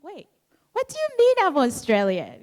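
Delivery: high, quick protesting question; mock bafflement. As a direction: react as if taken aback by the label. Acted baffled reply to relatives.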